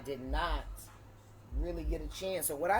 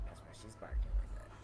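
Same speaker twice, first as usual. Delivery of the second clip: whispered aside; 9 semitones lower, 4 dB quieter, much faster than usual. Real, not acted. Aside about dog.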